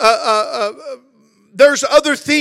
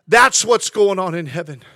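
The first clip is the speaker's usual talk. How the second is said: ordinary talk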